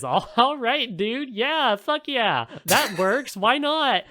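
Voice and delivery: high, loud, scornful "sure, why not"; mock him with exaggerated fake enthusiasm; sarcastic mock agreement. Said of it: "Beatrice just berates him and says".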